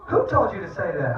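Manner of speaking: high, loud incredulous question; mock astonishment. Dad to son after a Father's Day wish, mock amazement. Dad reacting to son's greeting.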